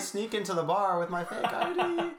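high, loud, hammed-up underage voice; mock squeaky teenager. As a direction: play an overexcited kid with a cracking voice. Plays squeaky underage bar-goer.

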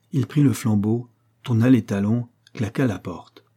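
ordinary delivery

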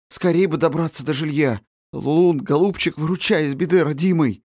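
high, pleading cry for help; desperate plea. Acted. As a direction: beg the dog to save them. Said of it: "вслух говорил Андрюша".

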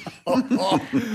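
short cocky laugh; boastful chuckle. Acted. Vito bragging to his friends, cocky chuckle. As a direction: chuckle at your own boast.

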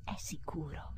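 hushed, under the breath; a fearful whispered aside. Acted. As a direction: whisper it to yourself in dread. Anxious inner monologue.

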